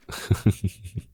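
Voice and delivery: airy little laugh through the pain; breathy laugh. Acted. Captor laughing off her slap with a breathy laugh.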